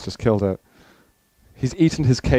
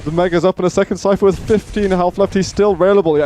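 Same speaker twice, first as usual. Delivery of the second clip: excited caster; 8 semitones higher, 3 dB louder than usual. Acted. Hyped cast during tense overtime.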